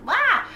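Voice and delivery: short, high-pitched "wah"; mock wail. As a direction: blurt a silly high wail. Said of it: "Sorry, I'm like".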